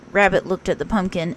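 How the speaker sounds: usual speaking voice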